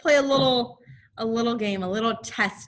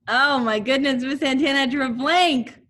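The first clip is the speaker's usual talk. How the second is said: playful mock dismay; 4 semitones higher, 6 dB louder than usual. Real, not acted. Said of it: "teasing herself for forgetting".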